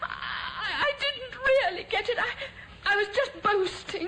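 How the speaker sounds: high, breaking, crying plea; terrified crying. Acted. Old woman cornered by the handyman, crying in terror. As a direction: sob out the lie in terror. Terrified victim lies about money.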